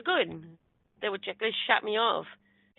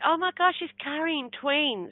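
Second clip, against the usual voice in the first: higher, drawn-out astonished realisation; retold amazement; 6 semitones higher than usual. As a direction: act out a sudden wondrous discovery. Retold amazed discovery of twins.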